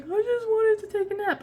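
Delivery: a whiny husband groaning about his nap; imitating the husband. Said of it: "And my husband's like".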